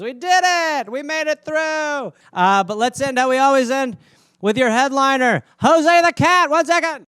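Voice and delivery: loud, high hype announcement; excited host intro. Real, not acted. Host announces the cat as headliner, loud and hyped. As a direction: build up the headliner like a showman.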